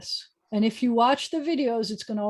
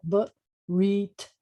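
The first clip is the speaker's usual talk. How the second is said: Barit drawn out in two separate syllables; sounded out. Shown as a demonstration. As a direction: sound out Barit slowly, bit by bit. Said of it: "can you sound it out?".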